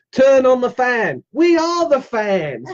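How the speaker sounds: high, drawn-out rallying call; mock fan enthusiasm. Calling out fan chant to viewers.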